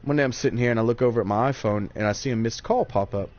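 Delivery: normal talking voice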